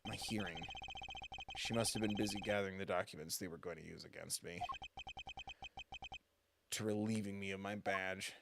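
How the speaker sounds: low, soft official voice; guarded committee member. Acted. Accused official refusing to share preparations, guarded and low. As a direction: keep it formal and hushed, voice dropping.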